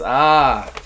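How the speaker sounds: hearty, drawn-out Russian greeting; Russian accent. Acted. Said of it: "our good friend the Russian".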